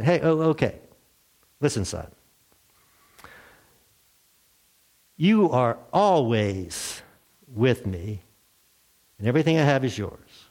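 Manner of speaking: calm the angry son tenderly; gentle father. Acted.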